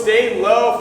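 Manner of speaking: usual speaking voice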